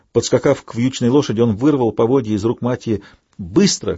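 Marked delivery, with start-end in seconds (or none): none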